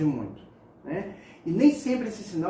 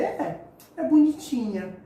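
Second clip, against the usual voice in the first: higher and slow, a light, sweet bonitinha; cute diminutive; 6 semitones higher, 3 dB louder, slower than usual. Shown as a demonstration. Teacher demonstrating the diminutive to students, sweetly. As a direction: say it like something small and cute. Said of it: "a sua expressão, ela é o que vai dizer a intensidade".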